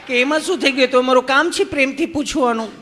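bright laughing answer; cheerful nurse. Retold or imitated. Nurse explains she's meant to ask lovingly, high and laughing.

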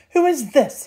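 high, indignant demand; rude shout. A narrator's reading. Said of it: "he shouted rudely".

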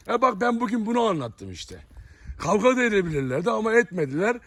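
ordinary delivery

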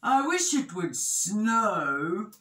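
slow, drawn-out wishful grumble; a touch of slow moaning; mild moan. A narrator's reading. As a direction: draw the wish out slowly. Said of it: "moaned Big Bear".

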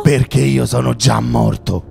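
lightly voiced headless horseman.

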